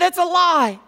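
high, loud flat refusal; mock angry denial. Shame rejects the narrator's message, high and loud. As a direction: snap it back as a lie.

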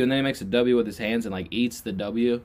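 usual speaking voice

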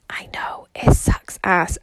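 hushed whispered aside; whispering. Real, not acted. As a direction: whisper it under your breath. Aside to listener.

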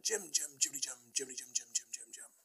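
put-on high-starting Scottish accent, sliding down; bad Scottish accent. Vlogger trying a line from Muppets Treasure Island, poor Scottish accent. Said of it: "I'm not very good at a Scotch accent".

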